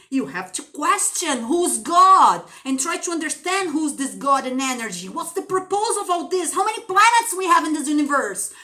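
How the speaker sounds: fervent sermon-like questioning; impassioned preaching. Real, not acted. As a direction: build the plea question after question.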